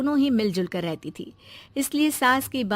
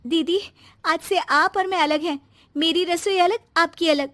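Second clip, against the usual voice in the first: higher and louder cold ultimatum; angry declaration; 5 semitones higher, 4 dB louder than usual. Acted. Young mother cuts off her sister-in-law, loud and angry. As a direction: lay down the split firmly.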